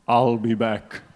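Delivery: Arnie impression. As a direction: growl it like Schwarzenegger's Terminator, Austrian accent.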